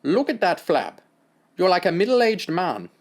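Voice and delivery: put-on German-accented doctor, scornful. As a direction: sneer at the boy in German accent.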